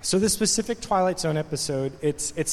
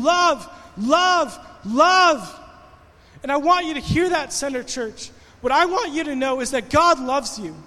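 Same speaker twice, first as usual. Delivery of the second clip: preaching at full force; 6 semitones higher than usual. Acted. Pastor urging Center Church, preaching at full force. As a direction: drive it home to the congregation.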